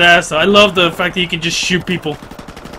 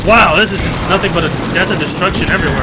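normal talking voice

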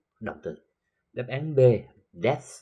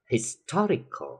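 "historical" slowly, with a high TOR; stress on TOR; 7 semitones higher, slower than usual. Shown as a demonstration. Teacher drilling the stress rule, TOR stressed in "historical". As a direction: stretch it and hit TOR, his-TOR-i-cal. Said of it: "cách nó một vần về phía trước".

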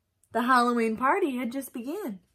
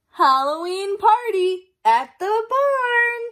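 announcing the note; 8 semitones higher, 7 dB louder, slower than usual. Acted. Reading the note aloud.

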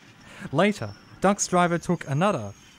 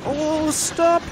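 very high, loud cry of alarm; panicked cry; 6 dB louder than usual. A narrator's reading. Fergus swerving as the points change, panicked cry. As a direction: yelp as he swerves. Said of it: "he cried".

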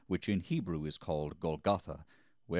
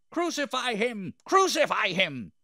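high mob shout, growing louder; crying out; 8 dB louder than usual. A narrator's reading. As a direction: chant for blood at full voice. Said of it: "they cried out".

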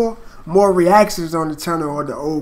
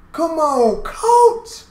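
hyped shout; 9 semitones higher, much slower than usual. Real, not acted. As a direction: shout it out, totally hyped by the verse. Hyped reaction after the verse.

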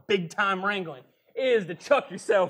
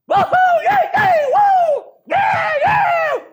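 wild whooping; 13 dB louder, slower than usual. Acted.

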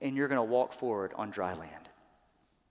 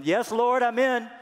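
high, loud eager acceptance; eager yes; 11 semitones higher, 10 dB louder than usual. Acted. Preacher voicing Moses agreeing eagerly. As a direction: throw out a bright eager yes, Lord.

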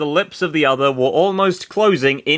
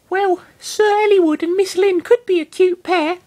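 put-on high girl voice; 4 dB louder than usual. Florina voiced in read fiction.

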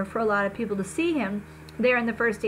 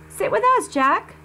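put-on higher, louder girl's voice calling across the room; Summer calling over; 9 semitones higher, 5 dB louder than usual. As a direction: call him over, bright and eager. Girl calls Jack over at lunch.

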